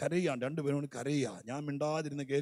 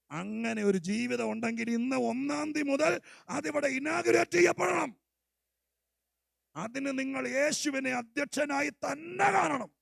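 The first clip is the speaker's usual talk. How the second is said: higher, louder climactic proclamation; full-force preaching; 10 semitones higher, 7 dB louder than usual. Acted. Pastor rousing the church to make Jesus the head, shouting.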